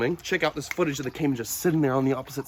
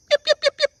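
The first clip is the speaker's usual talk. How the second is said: high yipping call; 4 dB louder, faster than usual. Real, not acted. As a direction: yip yip yip, sharp and much higher. Calling the dog.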